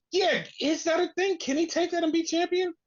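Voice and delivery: high-pitched mock disbelief; retold incredulous question. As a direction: squeak out your puzzled amazement. Retelling own incredulous reaction.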